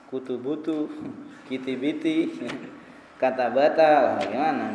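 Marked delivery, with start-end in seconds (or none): 0.1-0.9 "Kutubutu" with every vowel a u, said softly; mock a lost student reading "Kutubutu"; confused misreading. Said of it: "bingung antum ini bacanya gimana". 1.5-2.3 mock a lost student reading "kitibiti"; confused misreading. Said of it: "bingung antum ini bacanya gimana". 3.2-3.9 "kata bata" with every vowel an a, amused; mock a lost student reading "kata bata"; confused misreading. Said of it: "Nggak nyambung semua".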